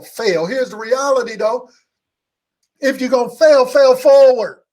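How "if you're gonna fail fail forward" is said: rising to a loud preacher's call; loud exhortation. Acted. Preacher urging the congregation to fail forward, loud exhortation. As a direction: push the room with a loud punchline.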